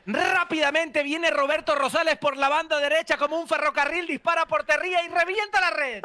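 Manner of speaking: high football commentator chant with rolled R's; radio goal commentary, rising as it goes; goal call. Shown as a demonstration. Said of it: "dime esa frase cantando un gol".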